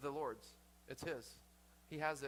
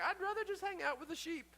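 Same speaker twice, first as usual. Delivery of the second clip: mock Moses; 13 semitones higher than usual. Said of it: "I'm sure Moses is like".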